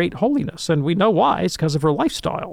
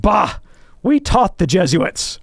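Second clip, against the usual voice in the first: retold indignant outburst, louder; 5 semitones higher, 4 dB louder, slower than usual. Irish Dominican priest answering a train passenger, indignant. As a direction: imitate an offended priest's scoff. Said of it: "And he got indignant".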